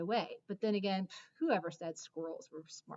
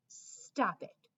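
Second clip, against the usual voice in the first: sharp voice. Acted. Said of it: "she says in a sharp voice".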